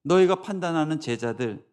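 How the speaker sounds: ordinary talk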